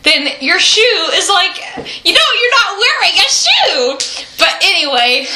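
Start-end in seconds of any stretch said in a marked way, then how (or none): 2.0-4.0 joking about having one boot, high goofy tone; silly joking voice. Real, not acted. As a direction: make the joke sound absurd.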